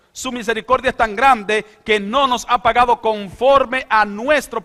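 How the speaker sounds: high, loud fervent preaching; full-force preaching. Acted. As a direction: preach it out to the whole congregation. Voices God ending the plague.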